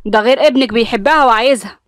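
put-on husband defending the girl, angry; irritated argument. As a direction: argue back hotly. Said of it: "حامد بنرفزة قال لها".

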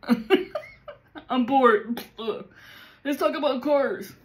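mock bored dude, scoffing and sighing; bored guy viewer. Guys watching the channel, mocking their bored scoffing voice. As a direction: imitate a bored guy brushing the video off. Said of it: "or they just go".

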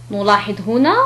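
normal talking voice

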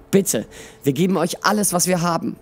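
high, pleading narrated voice; understated desperate begging; gasped plea. A narrator's reading. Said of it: "Zinetta keuchte".

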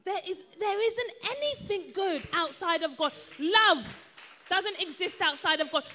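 emphatic sermon with punched key words; full-force preaching. Real, not acted. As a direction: preach it in rhythmic, building declarations.

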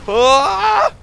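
high loud yell; sharp high cry; startled scream. Real, not acted. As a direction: scream in shock.

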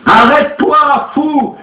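very high, loud scream; frantic shout. A narrator's reading. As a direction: grab him and scream stop. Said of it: "criait Madame Olcott".

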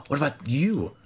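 ordinary talk, nothing marked

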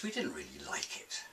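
softly whispered confession; whispered aside. Acted. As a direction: whisper it like a secret. Confiding aside.

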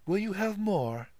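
put-on high, offhand serving boy; indifferent. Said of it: "asked the boy indifferently".

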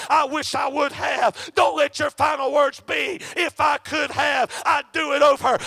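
loud, fervent, shouted sermon cadence; full-force preaching. Acted. Preacher calling the congregation to faith, shouting fervently. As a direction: hammer each line home to the congregation. Preacher urging listeners toward salvation.

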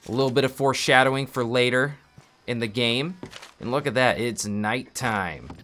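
usual speaking voice

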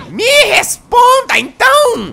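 high, loud demand; shouting. Pretend. Gamer demanding the squeaky voice answer him, loud high shout. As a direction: yell at the voice to answer. Yelling at the unseen voice.

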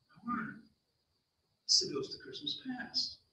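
whispered, awed realisation; very soft storyteller's shock; hushed dread. A narrator's reading. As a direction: drop to a near whisper, as if it just dawned. Frightened character realises it's a ghost.

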